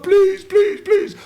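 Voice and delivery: very high, squealed begging; mock pleading. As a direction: imitate a terrified kid begging for mercy. Mimics kid begging in his car.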